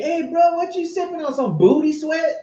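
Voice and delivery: high, bro-ish call to a stranger; mock street greeting. Imagined guy on the street asking about the drink, high bro voice. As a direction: greet a buddy on the street, hammed up.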